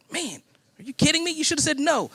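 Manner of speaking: high, dismayed outcry; exasperated disbelief. Retold or imitated. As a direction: toss it up high, can't believe she said yes. Dismayed wife agreed to move.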